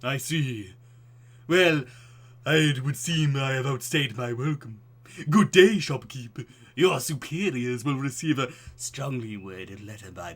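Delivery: loud, slow, theatrical horror-film gentleman; pompous old-world grandeur, booming; Vincent Price voice. Acted. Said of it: "my Vincent voice".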